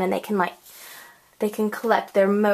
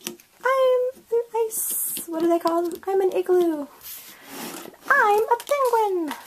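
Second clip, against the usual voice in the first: much higher, cute character squeak; squeaky penguin voice; 9 semitones higher than usual. Pretend. Crafter voicing the penguin sticker, high squeaky cartoon voice. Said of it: "high-pitched squeaky voice".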